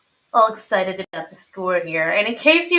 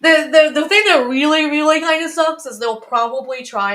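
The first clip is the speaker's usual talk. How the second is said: loud; 7 semitones higher, 9 dB louder than usual. Real, not acted. Frustrated rant about callback.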